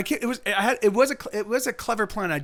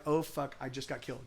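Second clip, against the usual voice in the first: much softer, life draining away; fading out; 4 semitones lower, 12 dB quieter than usual. Retold or imitated. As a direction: voice sinking as he goes down. Hit by a bat, dying.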